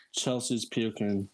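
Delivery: slow, low, unbothered imitation; calm report. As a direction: retell the alarm with total calm. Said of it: "he said it all calmly".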